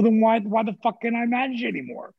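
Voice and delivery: high, heated retold protest; angry demand. Demanding why he can't manage, high and heated. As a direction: fire the question back at him. Arguing with boss over firing.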